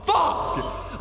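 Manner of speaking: sudden high breathy yelp of panic; mock yelp. Recounting the panic on the phone with her, a strangled yelped curse. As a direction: blurt the curse out in a strangled squeak.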